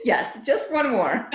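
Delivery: slow, amused answer through laughter; laughing. Real, not acted. Pet sitter laughing about the greedy pet, amused.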